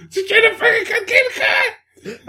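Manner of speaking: very high, loud hammed-up shriek; mock screamed order. Co-host re-enacting the film's car scene, hammed-up scream. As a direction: scream the order, stretching the last one. Acting out a film kidnapping.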